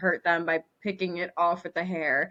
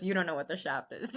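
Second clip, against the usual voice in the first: laughing; 13 semitones higher, 5 dB louder, faster than usual. Retold or imitated. Friend's teasing quote.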